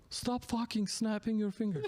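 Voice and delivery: heckling Turk. Acted. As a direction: cut in as an exasperated Turkish concertgoer. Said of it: "And the Turk goes".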